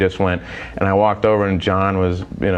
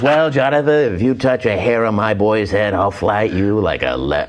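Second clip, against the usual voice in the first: mock-solemn radio host delivering a threat; Casey Kasem. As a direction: warn him in a swooping DJ cadence. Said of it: "He goes".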